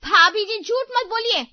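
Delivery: loud, sharp accusation; angry outburst. Acted. Sunita snapping loudly at bhabhi. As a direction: burst out at her sister-in-law. सहनशीलता का बांध टूट गया.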